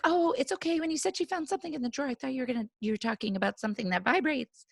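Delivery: high, soft voice for the other mom; reassuring mom. Retold or imitated. Mom reassuring stepmom by phone, imitated relief.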